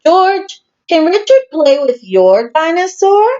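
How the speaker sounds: high, sweet mother voice; lightly voiced mummy; Mummy Pig asking. A narrator's reading. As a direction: ask the child kindly. Mummy Pig asks gently.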